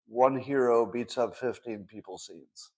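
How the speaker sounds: ordinary talk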